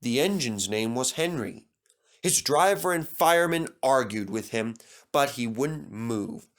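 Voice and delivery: usual speaking voice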